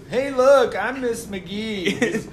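high, drawn-out silly voice; [name] imitating teacher. [name] attempting his math teacher imitation, in high silly voice. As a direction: play a badly faked teacher impression. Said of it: "He goes".

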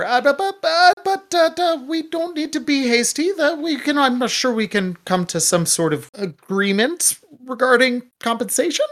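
high-pitched nervous stammer; frantic stalling, stuck on the first words. Acted. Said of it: "And Deck will stammer".

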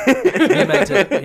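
laughing, drawn out slowly. Real, not acted. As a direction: laugh through the words. Teasing after slip.

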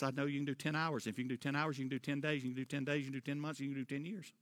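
ordinary talk, nothing marked

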